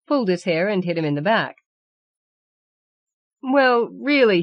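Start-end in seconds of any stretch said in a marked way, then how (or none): 3.4-4.4 slow, higher, drawn-out exasperation; exasperated. Acted. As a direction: sigh it out as if fed up. Character complains to the narrator.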